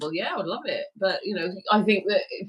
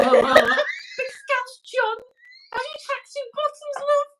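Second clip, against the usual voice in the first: high mock Scouse woman. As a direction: squeal it as a Scouse woman through laughter.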